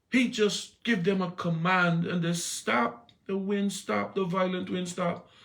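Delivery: high, loud preaching with a shout; full-force preaching. Acted. Preacher telling the church Jesus has power, preached full force. Preaching the storm story, raised.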